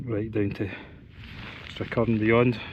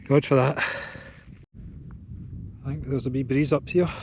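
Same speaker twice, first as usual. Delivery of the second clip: ordinary delivery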